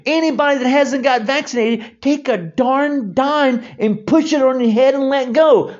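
high, loud, exasperated yelling; furious shout. Real, not acted. Ranting at the unvaccinated about the magnet claim, furious shout. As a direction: shout them down in disbelief.

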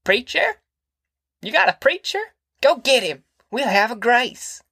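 put-on high, shrill eager cry of an old granny; shrill out at the family for a preacher; shrill old woman. A narrator's reading. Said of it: "raised a shrill voice".

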